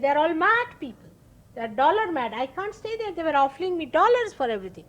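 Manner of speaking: high, loud scornful outburst; quoting the guru's rising exasperation, fast at the end; retold exasperated rant. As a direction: spit out his disgust at the money-mad city. Guru's disgust with New York.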